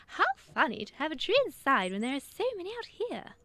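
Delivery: high, loud amused bird voice; amused Dove. A narrator's reading. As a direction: Dove marvelling, half laughing at the idea. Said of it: "commented Dove".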